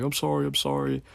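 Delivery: retelling the crying worker's apology, high and pleading; mock pleading apology. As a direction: imitate a tearful guy begging forgiveness.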